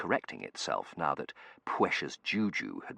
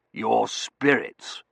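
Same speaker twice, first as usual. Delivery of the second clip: gruff colonel voice; 5 semitones higher, 7 dB louder, much slower than usual. Acted. Colonel character voice.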